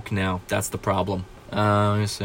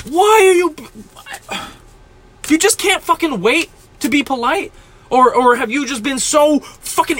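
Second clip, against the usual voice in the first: angry outburst; 9 semitones higher than usual. Real, not acted. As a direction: let the anger burst out at them. Ranting at impatient rude people.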